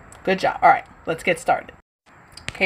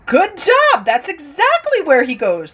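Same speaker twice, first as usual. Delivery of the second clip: high bright cheer; excited praise; 8 semitones higher, 5 dB louder than usual. Real, not acted. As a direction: enthusiastic teacher praise. Praising the children.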